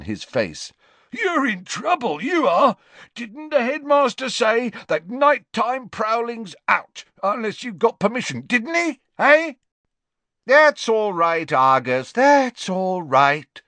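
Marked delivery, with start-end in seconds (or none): 1.1-9.5 high, eager caretaker voice; a spiteful tattletale, pleased; gleeful Filch. Acted. Caretaker reporting Malfoy to Slughorn, high and gleeful. 10.5-13.6 put-on high, hearty old professor voice; a fond, tipsy potions master; jovial Slughorn. Tipsy teacher praising Harry to Trelawney, high and hearty.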